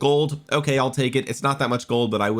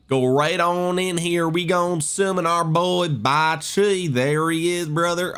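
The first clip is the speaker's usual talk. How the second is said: slow, higher-pitched southern drawl; southern accent; 6 semitones higher, slower than usual. Real, not acted. Player about to summon his commander, slipping into southern accent. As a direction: stretch it out in a folksy southern accent. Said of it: "I don't know where my southern accent came from".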